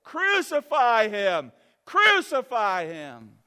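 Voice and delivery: loud, slow mob shout; crowd shouting. Acted. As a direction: bellow it like a bloodthirsty crowd. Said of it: "And they said".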